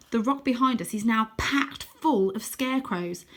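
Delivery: usual speaking voice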